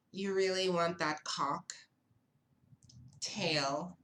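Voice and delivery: "You really want that" then a long pause before "cocktail?", ending in a rise; pause between cock and tail. Retold or imitated. Waiter mocking the diner's drink order, with a sly pause. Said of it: "He literally paused between cock and tail".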